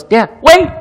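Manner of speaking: put-on high child's cry, dad wait; little boy calling out. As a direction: call out like an eager little boy. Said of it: "and he goes".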